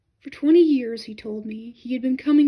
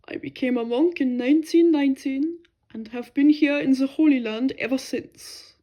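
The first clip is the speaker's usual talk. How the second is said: lightly voiced old monk, calm and matter-of-fact; a hint of a foreign monk, unruffled; German monk; 6 dB louder than usual. A narrator's reading. Monk telling the narrator his story, mildly voiced and calm.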